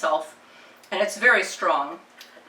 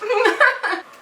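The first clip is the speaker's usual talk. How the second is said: high burst of laughter; 6 dB louder than usual. Real, not acted. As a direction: giggle of laughter, pitch high. Laughing at own burn mishap.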